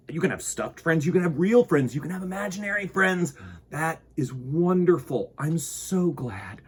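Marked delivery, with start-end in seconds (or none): none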